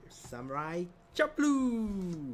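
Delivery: loud, very slow drawn-out title announce; booming slow anime title call; dramatic title call. Acted. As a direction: stretch out the show name like a trailer voice.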